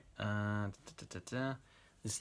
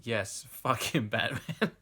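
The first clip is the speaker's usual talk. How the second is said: slow, half-raised cheer; mild mock scream; 3 semitones higher, 8 dB louder, much slower than usual. A narrator's reading. Woman urging on Batman in the story, mild mock scream. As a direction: drag out the egging-on without really screaming. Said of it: "masturbating and screaming".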